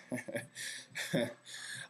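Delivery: soft, breathy chuckle to himself; amused laugh. Real, not acted. Vlogger tickled by his own face to face line, quiet laugh. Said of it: "I'm laughing because do it face to face".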